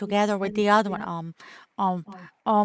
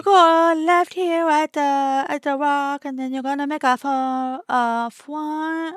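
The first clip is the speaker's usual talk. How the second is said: hammed-up fake accent, higher and louder, W for R; 5 semitones higher, 5 dB louder than usual.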